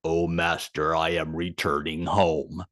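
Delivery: low, slow, eerie horse voice speaking like a man; disturbing human voice. Retold or imitated. Horse speaking to its master, in a low uncanny voice. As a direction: make the horse sound uncanny, deep and slow. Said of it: "said in a very disturbing human voice".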